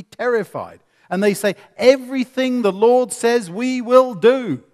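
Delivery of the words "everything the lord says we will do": high, loud, slow vow of obedience; fearful vow. Retold or imitated. Terrified Israelites vowing to God at Sinai, fearful and loud. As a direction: promise everything out of fear.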